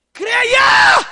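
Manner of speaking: high, loud shout; preacher roaring at the crowd; shouted command. Acted. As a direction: command them to believe.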